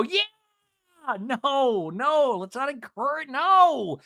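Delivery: mock audience cheer then protest. Host retelling the audience cheering, high and mocking. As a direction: ham up the crowd and then shut it down. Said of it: "these dum-dums go".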